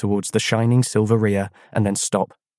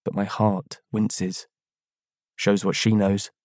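ordinary delivery, nothing marked

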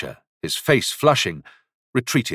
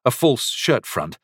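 ordinary delivery

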